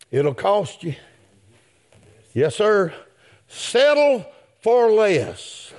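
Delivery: normal talking voice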